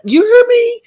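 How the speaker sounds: high, loud squeal; sudden piercing high holler; emphatic call-out. Real, not acted. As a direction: shout it out sky-high to the viewers. Calling out to listeners for agreement.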